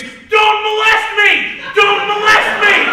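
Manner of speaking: high, loud, slow scream of a broken phrase; mock scream. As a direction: bellow it slowly at the customers. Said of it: "walks up and screams".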